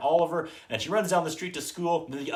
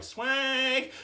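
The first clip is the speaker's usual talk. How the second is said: impressed; 12 semitones higher than usual. Retold or imitated. Dogs voiced admiring the house.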